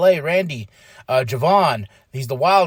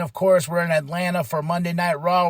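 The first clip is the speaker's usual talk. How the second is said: normal talking voice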